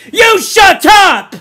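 high, slow, very loud order; Jack's furious shout. A narrator's reading. Jack turns on Piggy, furious shout. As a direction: turn on him and shout him down. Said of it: "Jack turned on him".

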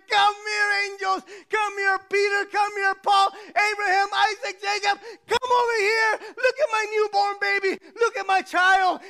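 high, exultant calling out; God all excited. Acted. God calling angels to see his child, all excited. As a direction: shout it like a thrilled parent showing off. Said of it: "That's how God gets all excited".

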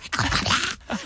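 stern whisper, retried. Retold or imitated. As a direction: hush and warn him. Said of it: "c'est pas ça".

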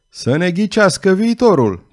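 women shrieking demands across the tavern; shrieked. A narrator's reading. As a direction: cry out for the fortune. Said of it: "țipară câteva femei".

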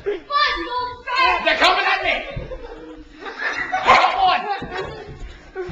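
shouting. Real, not acted. Kids wrestling, mock fight narration.

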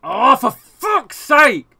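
loud, high, slow-stretched curse; frustrated outburst. Real, not acted. Gamer getting so frustrated at the match, exasperated shout. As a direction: let real frustration burst out. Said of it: "I'm getting so frustrated".

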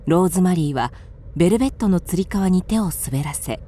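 ordinary delivery

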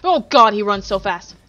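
panicked, loud and slow; scared breathless cry; being chased. Real, not acted.

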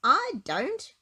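loud, high storybook moan. A narrator's reading. Little Miss Brainy, blown into the air, moaning loudly. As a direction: moan it in complaint. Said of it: "moaned little Miss Brainy".

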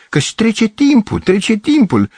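high-pitched, insistent reminder; urgent prodding. A narrator's reading. Girls warning Gavrilescu that time passes, urgent prodding. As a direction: hurry him along in a high voice. Girls urging him to hurry.

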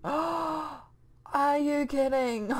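slow, stunned gasp; gasp, then disbelieving; gasping disbelief. Real, not acted. Gasps at long run.

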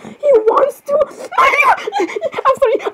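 very high-pitched, laughing shriek; overexcited squeal. Acted. Girls hearing he wants to meet them, squealing. Squealing at his introduction line.

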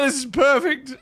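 high squealing panicked laughter; shrieking laugh. Real, not acted. Fleeing a skeleton, squealing laughter.

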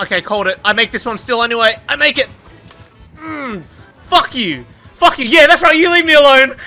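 ordinary delivery

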